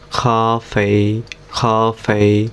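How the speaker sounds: খা ফেই said very slowly, syllable by syllable; slow syllable drill. Shown as a demonstration. Teacher drilling a compound word slowly for students. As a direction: stretch খা ফেই out slowly for learners. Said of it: "কীভাবে উচ্চারণ হবে".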